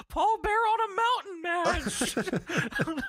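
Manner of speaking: mock squeaky voice, laughing. Mocks in high voice.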